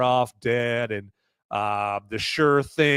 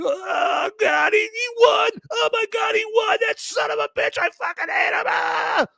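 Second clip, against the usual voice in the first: very high, wailing fake sob; mock crying. De Niro imagined crying in a corner after Trump won, hammed-up wail. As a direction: bawl it out in a squeaky hammed-up voice. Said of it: "just crying".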